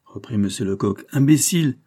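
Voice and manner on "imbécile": louder, higher scornful repeat; mocking shout. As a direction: fling the word back at him in scorn. Lecoq throws the insult back. (répétition moqueuse, plus forte et plus aiguë)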